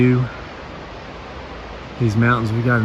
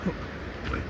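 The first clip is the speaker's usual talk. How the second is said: hushed; 17 dB quieter than usual. Real, not acted. Hushed while spotting something.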